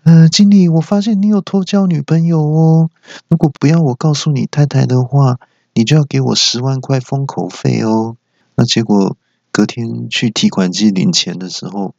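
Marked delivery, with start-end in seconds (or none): 0.0-8.1 mock blackmail. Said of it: "不然就是说".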